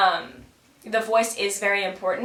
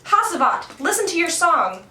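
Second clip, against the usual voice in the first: higher, louder puppet threat; angry Karagos; 5 semitones higher, 4 dB louder than usual. Acted. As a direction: pick a fight with the singer. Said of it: "the fight going down".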